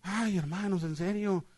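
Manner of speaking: soft, halting know-it-all testimony; lightly mocked congregant, quiet and stumbling; imitated church member. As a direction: imitate someone hesitating, then hurrying it out. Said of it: "diario así me decía".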